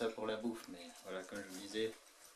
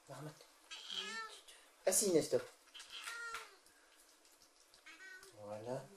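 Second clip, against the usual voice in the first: talking to a cat; 7 semitones higher than usual. Real, not acted. Training cats with treats.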